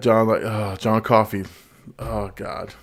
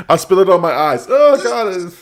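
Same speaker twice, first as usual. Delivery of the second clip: high laughing after spilling water; laughing fit; 10 semitones higher than usual. Real, not acted. Spilling water on himself, laughing high. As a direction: laugh it off, pitch climbing high.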